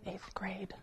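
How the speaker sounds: breathy whispered correction; whispered aside. Real, not acted. Preacher correcting himself to the congregation in a whisper. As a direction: drop to a whisper to fix the year.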